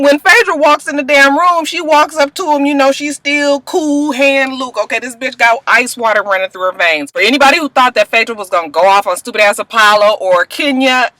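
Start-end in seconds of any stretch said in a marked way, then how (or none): none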